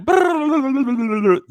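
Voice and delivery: loud burst of laughter; real laugh. Real, not acted. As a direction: laugh out hard. Laughs at zero.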